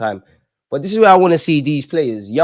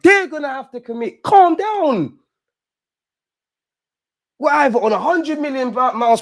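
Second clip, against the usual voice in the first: much higher, loud pleading yell at the telly; high frantic yell begging the players to relax; exasperated high shout; 14 semitones higher, 4 dB louder, slower than usual. Real, not acted. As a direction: shout at your team to calm down, slowly at first. Fan pleading with team on screen.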